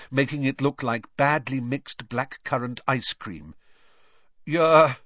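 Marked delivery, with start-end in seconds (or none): 4.5-5.0 halting, loud, higher-pitched attempt at calm; would-be calm. A narrator's reading. Uncle hears of the godfather letters, would-be calm. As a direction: try and fail to sound unbothered. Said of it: "in a would-be calm voice".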